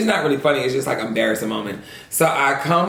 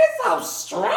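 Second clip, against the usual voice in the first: high, soft disgusted squeak; 4 dB quieter than usual. Retold or imitated. Retold reaction to smell.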